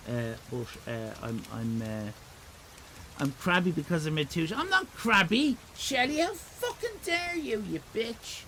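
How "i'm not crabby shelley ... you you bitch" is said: high, indignant mock fury; mock outrage. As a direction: snap back in playful outrage, pitch shooting up. Mock outrage at chat member.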